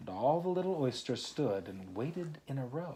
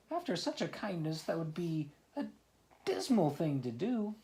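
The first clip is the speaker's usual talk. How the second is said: high oyster voice; 7 semitones higher than usual. Acted. Oysters protesting.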